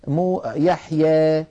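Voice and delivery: يحيى slowly with its final alef leaning toward e; light imala. Shown as a demonstration. Teacher imitating the relaxed jaw of يحيى. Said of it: "هكذا يرخون، آه، حنكهم قليلا".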